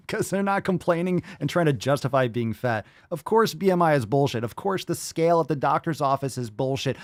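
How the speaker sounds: ordinary delivery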